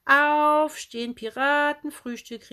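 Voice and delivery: high, slow, drawn-out wake-up shout; a child calling everyone to breakfast; loud wake-up call. Acted. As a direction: holler it up the stairs. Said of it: "rief sie".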